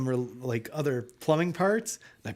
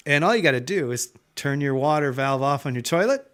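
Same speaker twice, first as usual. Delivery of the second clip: ordinary talk, nothing marked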